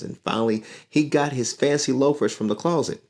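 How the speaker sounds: lively storybook reading; reading to children. A narrator's reading. Teacher reading a picture book to her class, lively. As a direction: read the story aloud to young listeners.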